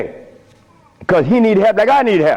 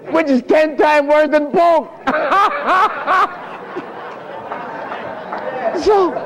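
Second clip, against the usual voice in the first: laughing; 11 semitones higher than usual. Real, not acted. Joking about eating lamb.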